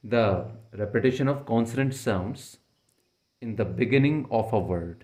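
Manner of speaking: very slow, definition read out for notes; slow and clear like dictating; slow dictation. Shown as a demonstration. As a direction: dictate each word slowly. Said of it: "definition ये हो गई".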